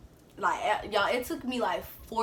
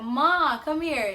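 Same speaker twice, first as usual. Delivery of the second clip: higher, louder call across the house; calling out to mom; 6 semitones higher, 7 dB louder, much slower than usual. Retold or imitated. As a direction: call mom in, a bit nervous.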